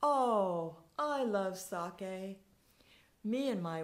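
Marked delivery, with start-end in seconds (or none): 0.0-2.4 raised, slow, delighted exclamation; a hint of a beaming old man. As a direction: savour it like a happy old man. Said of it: "still beaming".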